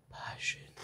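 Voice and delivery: voiceless whisper. A narrator's reading. Father naming each diner's origin to his child, whispering. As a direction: drop to a hushed whisper.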